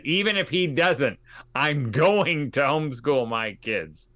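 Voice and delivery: loud, emphatic vow, slowing as it goes; determined. Retold or imitated. Mother resolving to homeschool whether her husband helps or not, forcefully determined.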